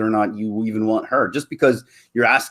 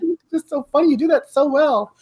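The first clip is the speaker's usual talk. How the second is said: put-on relieved girl; 14 semitones higher than usual. Girl reacts to the pickup line, relieved and amused. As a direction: play a relieved girl, lightly. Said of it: "they relax and they go".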